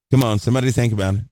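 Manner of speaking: urging call-out. Real, not acted. Preacher urging congregation.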